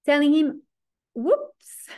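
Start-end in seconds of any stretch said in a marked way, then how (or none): 1.1-1.9 high startled whoops; a sudden yelp of alarm. Acted. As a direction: caught out, a mock gasp. Yehuda voiced realizing trouble.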